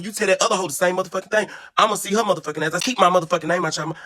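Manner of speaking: high, heated retold rant; mock fury at her; heated telling off. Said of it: "I'm like".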